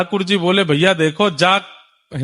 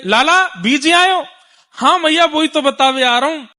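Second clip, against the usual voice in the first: calling out; 7 semitones higher than usual. Acted. Mother calls from afar, child answers.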